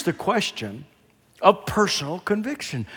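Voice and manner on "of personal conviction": ordinary talk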